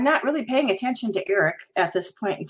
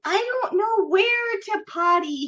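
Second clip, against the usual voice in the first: put-on high, slow, childish wail; mock helpless child, words dragged out; husband's put-on simpleton voice; 10 semitones higher than usual. Wife imitating husband playing helpless in public, childish voice. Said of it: "act like a special ed person".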